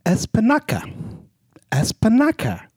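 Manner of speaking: funny voice. Acted. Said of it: "I gotta think of a voice here".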